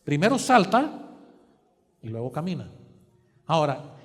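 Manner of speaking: loud, driving sermon delivery, punching key words; building preacher's call; fervent preaching. Acted. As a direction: preach it out to the congregation. Preaching at full force.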